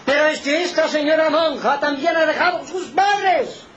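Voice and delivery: high, forceful pulpit outcry; high-pitched preaching protest; impassioned preaching. Real, not acted. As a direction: cry it out to the congregation.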